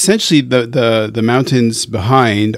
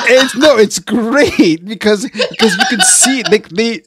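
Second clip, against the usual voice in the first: high-pitched words through giggles; real helpless laughing; 8 semitones higher than usual. Real, not acted. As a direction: try to reassure while cracking up. Cracks up explaining the compliment.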